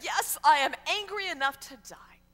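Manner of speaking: loud, high, indignant retort; angry Jonah. Acted. Preacher plays Jonah answering God, angry and loud. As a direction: fire it back in anger. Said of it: "to be so angry".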